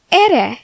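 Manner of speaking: "Ere" said with no N sound at the end; "Ere" as Mikasa says it, no final N; dropped final N. Retold or imitated. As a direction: say "Ere" ending on the open vowel, N left off. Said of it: "without even pronouncing the N".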